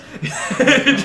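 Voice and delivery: high burst of laughter; loud laugh. Real, not acted. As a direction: break into a loud cackle. Laughing at the fight prospect.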